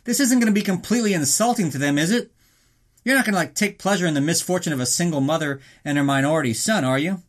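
a fan's mock suspicious jab; mock wary listener. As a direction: needle the host like a suspicious fan. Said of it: "You were like".

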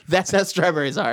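normal talking voice

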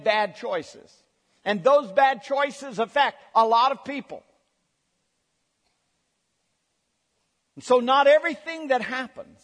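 rising fervent sermon voice; preaching at full force. Acted. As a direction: build it up like a pulpit preacher.

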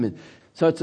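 usual speaking voice

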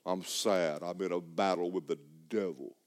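low, subdued mock gloom; mock sad. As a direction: moan it quietly, sorry for yourself. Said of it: "looking like you're in a fight all the time".

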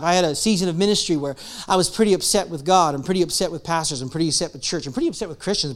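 ordinary talk

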